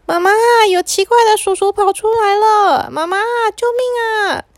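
put-on high, frightened child's call for mom; scared cry for help. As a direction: shriek for mom like a scared little kid. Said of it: "他们就大叫说". (惊慌的高声呼救)

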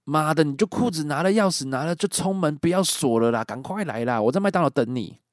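urgent, irritated commands; angry. Retold or imitated. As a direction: bark orders at the frightened friend. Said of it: "我很怒的跟他说".

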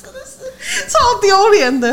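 high, slow, laughing lament; laughing in embarrassment. Real, not acted. Friend ending her train story, laughing. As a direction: wail it through laughter.

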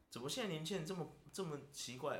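ordinary talk, nothing marked

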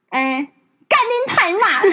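put-on high, slow kid yelling a curse; child swearing. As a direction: drag out the curse like a furious toddler. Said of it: "我好像就讲说".

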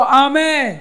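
high, loud amen; ringing amen. Shown as a demonstration. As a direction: cap it with a shouted amen. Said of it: "你要大声的来读".

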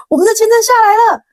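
high, loud, fast burst of joy; excited announcement. Retold or imitated. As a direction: rush the news out breathless with relief. Telling friends the visa came. (激动地对朋友们高声宣布好消息)

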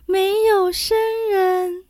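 thin high little voice. A narrator's reading. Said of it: "细声细气地回答".

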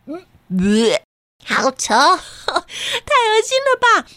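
slow high grossed-out groan; disgusted. Acted. Reacting to smelly socks.

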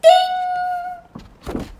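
sustained high bell ding. Shown as a demonstration. Page turning sound effect.

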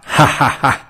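a short, smug stage laugh; ha-ha-ha, gloating over the stuck rivals; mocking laugh. Yan general laughs off Zhao's two ministers, smug mocking laugh. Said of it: "他笑着回答说".

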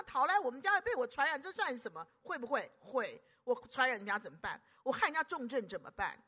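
ordinary delivery

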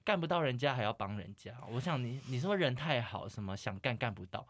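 ordinary talk

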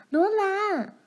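high, urgent call of a name; a big sister calling out in alarm; older sister calls to Laura, high urgent call. A narrator's reading. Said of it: "玛丽叫道".

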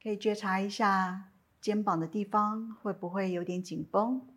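calm, unhurried meditation guidance with long pauses; guided meditation. Acted. Teacher guiding listeners lying down in a body scan, calm meditation voice.

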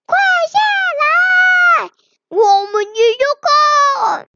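high, indignant shouts with stretched commands; animals yelling from below. A narrator's reading. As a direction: shout up a high-pitched scolding. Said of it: "一大群动物在下面大喊大叫".

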